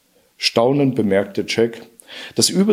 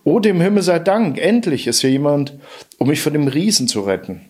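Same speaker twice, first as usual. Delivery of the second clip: high, breathless relief; fluttering cries for help; 4 semitones higher than usual. Acted. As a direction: gush with desperate relief. Trapped harp begs Jack for help.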